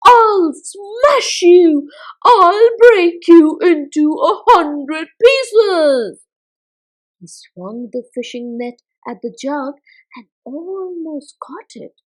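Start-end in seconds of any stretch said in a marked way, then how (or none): 0.0-6.2 slow, loud, crying rage; furious shout. Acted. Miggle shouting at the jug that poured treacle on him. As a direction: bellow the threat at the top of your voice. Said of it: "he shouted at the top of his voice".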